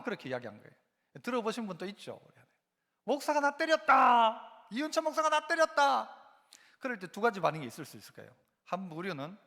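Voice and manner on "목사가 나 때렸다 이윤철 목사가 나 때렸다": raised, drawn-out complaint for all to hear; mock accusing cry. Churchgoer crying out against the pastor, mock outcry. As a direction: cry out an accusation, higher and louder.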